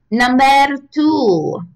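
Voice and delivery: put-on slow, drawn-out mystery accent on "Number two"; unplaceable put-on accent. Host announcing her next point in an unplaceable accent. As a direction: stretch "Number two" out slowly in a made-up accent. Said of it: "I don't know what accent that was".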